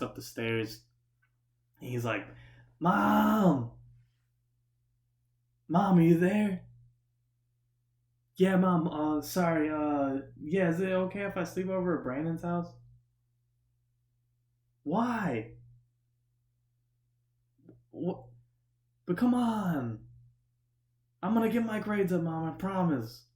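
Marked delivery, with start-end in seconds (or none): 2.8-6.6 loud, high call up the stairs; mock screaming. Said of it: "screaming at the top of his lungs". 8.4-12.7 raised, higher pleading voice; mock calling out. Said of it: "screaming at the top of his lungs". 14.8-23.2 put-on high, pleading kid voice; mock hollered protest. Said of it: "screaming at the top of his lungs".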